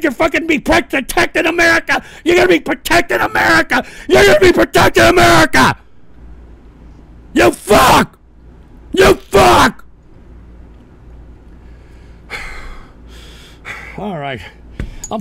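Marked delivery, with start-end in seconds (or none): 0.0-9.9 high yelling that builds to a scream; furious shouting. Real, not acted. Host rebukes chatter over protecting America, furious shouting. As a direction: escalate with each repeat into rage.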